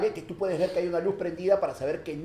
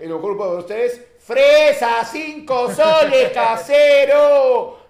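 street fruit vendor call; 3 semitones higher, 10 dB louder, much slower than usual. Retold or imitated. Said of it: "no, dice".

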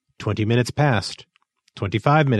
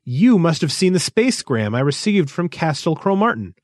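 high-pitched, insistent demand; 7 semitones higher than usual. A narrator's reading. Thistle confronting Rolver about the spacegram, demanding. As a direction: press the point as a demand. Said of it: "demanded Thistle".

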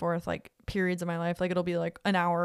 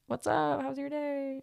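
much higher, sugary greeting; sweet chirpy; 8 semitones higher than usual. Retold or imitated. Imitating her eager texts to the match, higher and sugary. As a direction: sound bright and eager.

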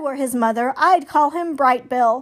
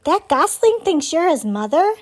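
put-on young beaver's question; 5 semitones higher, 5 dB louder than usual. A narrator's reading. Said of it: "came a quiet voice".

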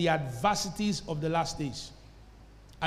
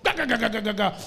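rapid-fire mouthed gun rattle; imitated gunfire; 3 semitones higher, 3 dB louder, faster than usual. Preacher tells what the five-year-old heard, imitating gunfire. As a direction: stutter it out like a gun firing. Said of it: "he heard the gun".

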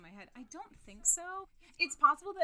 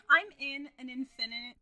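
mock drama; 2 semitones higher, 18 dB louder than usual. Continuing mock phone escape.

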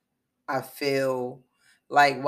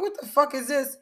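much higher, startled outburst; shocked disbelief; 12 semitones higher than usual. Retold or imitated. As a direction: react like you can't believe what you see. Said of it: "I'm like".

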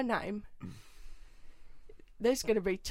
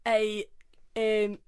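ordinary delivery

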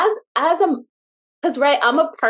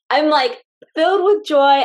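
higher, louder, bursting with joy; joyful; 7 semitones higher, 6 dB louder than usual. Real, not acted. Telling her friend about a recovered client, beaming and loud. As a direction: let the joy spill out. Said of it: "I'm so happy".

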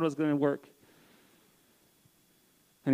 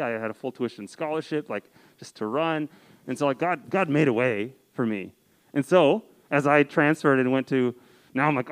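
ordinary delivery

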